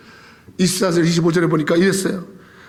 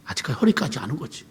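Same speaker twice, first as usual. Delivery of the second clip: much softer and lower, almost to himself; soft lower echo of the line; hushed repeat; 7 semitones lower, 8 dB quieter, faster than usual. Real, not acted. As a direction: drop the voice right down on the repeat.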